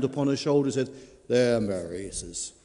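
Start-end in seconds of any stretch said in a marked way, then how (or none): 1.3-2.1 low farmer's voice. Acted. Farmer comforts his wife.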